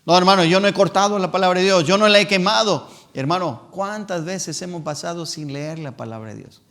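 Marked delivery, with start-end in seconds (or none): 0.0-2.9 louder, pushier voice of a defensive churchgoer; mock the lazy believer's excuse. Preacher imitating a believer who makes excuses, louder.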